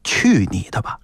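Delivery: deep, grave and mock-intimate; low, confidential murmur. Collector brushing off a tout in his ear, low and mock-kindly. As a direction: drop low, as if sharing a kind secret. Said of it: "还要低沉的语调， 小声的、 极其亲切的说一句".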